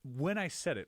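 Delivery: normal talking voice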